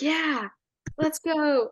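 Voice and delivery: sarcastic cheer. Retold or imitated. Sideline friend egging on his losing friends, sarcastic. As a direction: retell a fake pep talk without shouting. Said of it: "totally sarcastically".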